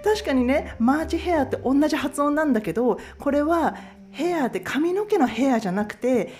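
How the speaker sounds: high, laughing apology and explanation; laughing coworker. Retold or imitated. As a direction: laugh through the correction like an amused coworker. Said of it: "って言われて".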